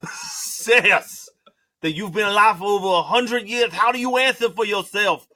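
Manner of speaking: lisping, high, loud accusation. Pretend. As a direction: confront him with a lisp. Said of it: "your little lisp there".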